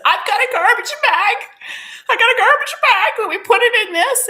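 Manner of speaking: high, loud, near-wailing retold plea; re-enacted hysterical shout; distraught cry. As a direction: cry it out at the top of the voice, desperate. Distraught, offers bag to stranger.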